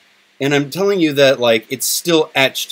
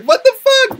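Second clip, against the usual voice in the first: delighted surprise; 5 dB louder than usual. Retold or imitated. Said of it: "And I'm just like".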